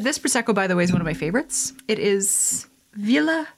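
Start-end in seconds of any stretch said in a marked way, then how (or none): 1.9-3.5 put-on hushed, slowed Italian accent. Vlogger naming her favourite Prosecco in a put-on Italian accent. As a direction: savour the wine name like an Italian. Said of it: "speaking in an Italian accent".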